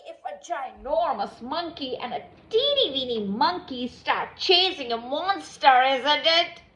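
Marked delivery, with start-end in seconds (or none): none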